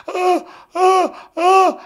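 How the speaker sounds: high, sharp gasps for air; panicked gasps. Acted. Man covering himself before an intruder, acted panicked gasps. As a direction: act out breathless fright in short high bursts. Reenacting panic at the thin figure.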